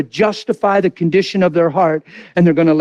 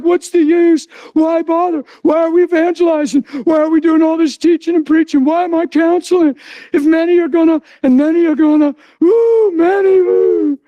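high, worked-up despairing protest; rising lament ending in high wails; retold fit; 11 semitones higher than usual. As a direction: act out throwing a fit at God. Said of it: "I was just having a fit".

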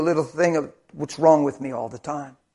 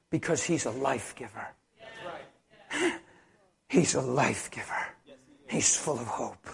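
much softer, lower, intimate; hushed reverent sermon; soft tender preaching; 3 semitones lower, 8 dB quieter than usual. Acted. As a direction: murmur it gently to the flock.

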